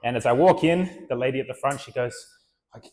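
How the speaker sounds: ordinary talk, nothing marked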